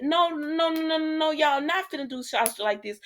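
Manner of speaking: high-pitched string of objections; acted protest. Retells her protest at the show.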